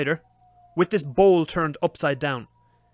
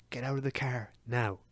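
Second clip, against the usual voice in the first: low, soft, firm order; hushed insistence; 5 semitones lower, 11 dB quieter than usual. Retold or imitated. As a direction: repeat it quietly, meaning it. Repeats hushed order to wife.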